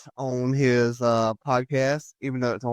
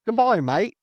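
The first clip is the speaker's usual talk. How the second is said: much higher, lightly faked Australian accent; mock Australian bye; 9 semitones higher, 4 dB louder than usual. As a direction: toss off a cheery fake Aussie goodbye. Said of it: "how do you do bye in, in Australia?".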